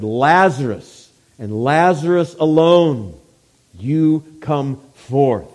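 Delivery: slow, loud, deliberate summons; drawn-out, forceful command; calling out. Retold or imitated. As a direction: call one person out, word by word. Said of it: "calls out".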